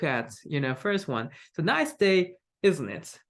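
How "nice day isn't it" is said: nice day set higher, isn't it falling; sure, falling tag. Shown as a demonstration. Speaker sure the listener will agree, falling on isn't it. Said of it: "it implies that a speaker is sure a listener will agree".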